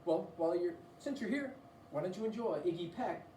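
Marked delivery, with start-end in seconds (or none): none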